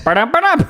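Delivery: high-pitched vocal clown fanfare; mock fanfare. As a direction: sound out a silly little trumpet flourish. Imitates clown fanfare before squirting water.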